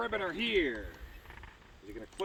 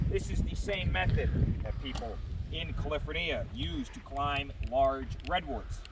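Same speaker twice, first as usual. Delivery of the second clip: normal talking voice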